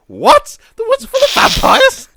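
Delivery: very high, loud shriek of disbelief; husband shrieking back the local's words in horror; horrified disbelief. Retold or imitated. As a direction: squeal it, totally aghast. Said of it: "And he goes".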